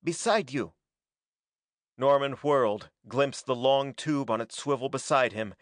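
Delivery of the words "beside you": mildly urgent call; a restrained cry of direction. A narrator's reading. Green man points Norman to the gun, urgent cry. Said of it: "cried the green man".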